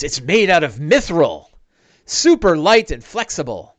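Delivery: loud, emphatic boast; mildly excited, punched-up delivery; proud enthusiasm. Acted. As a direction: show off a prized treasure. Big Al shows off the armor.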